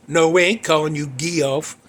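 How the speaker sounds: normal talking voice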